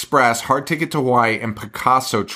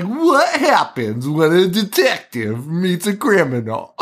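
high, hammed-up movie trailer narrator; mock a cheesy trailer voice-over, swooping up high; 6 semitones higher than usual. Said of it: "It has a narrator that's like".

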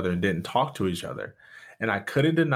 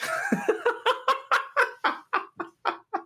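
high laugh. Real, not acted. Laughing at own story.

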